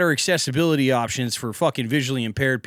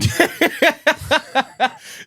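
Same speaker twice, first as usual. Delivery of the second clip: high burst of helpless laughter; big laugh; 13 semitones higher than usual. Real, not acted. Laughing at the story.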